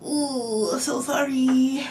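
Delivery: high, slow cooing pity; mock sympathy. Reviewer pities the film's heroine, in high mock sympathy. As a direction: coo it with put-on sorrow.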